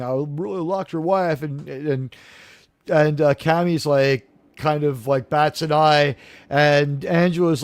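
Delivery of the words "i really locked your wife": ordinary talk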